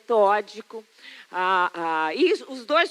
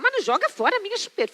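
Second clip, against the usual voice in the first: put-on higher, quick childish plea; pleading toddler; 8 semitones higher, faster than usual. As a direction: beg like a little boy worried for his pacifier. Toddler pleading not to discard.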